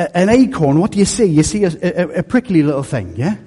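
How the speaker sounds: usual speaking voice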